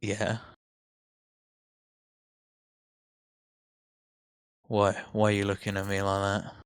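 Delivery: put-on sulky teenage whine; a moody teen, annoyed at the stare; Festus as annoyed teenager. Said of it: "annoyed teenage tone".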